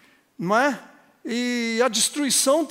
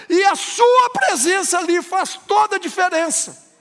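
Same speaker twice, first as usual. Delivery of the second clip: higher, louder fiery sermon voice; full-force preaching; 10 semitones higher, 7 dB louder than usual. Acted. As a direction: preach it out with rising fervor. (pregação inflamada, mais alta e forte)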